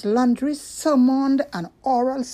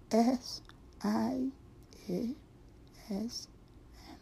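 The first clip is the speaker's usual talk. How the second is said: much softer, fading out; dropping voice low; 13 dB quieter than usual. Acted. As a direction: let the last letters drop away. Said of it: "she dropped her voice so low".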